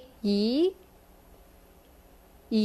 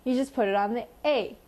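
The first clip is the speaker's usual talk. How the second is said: normal talking voice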